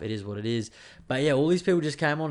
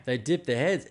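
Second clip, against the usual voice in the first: ordinary talk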